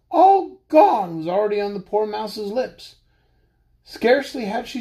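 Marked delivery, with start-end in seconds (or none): 0.1-1.2 frightened mouse. Acted. Mouse's last words, high.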